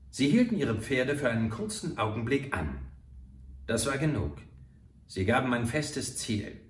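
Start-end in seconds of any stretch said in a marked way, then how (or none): none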